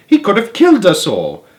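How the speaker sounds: put-on high, loud old woman; indignant cry; outraged. A narrator's reading. Grandma outraged.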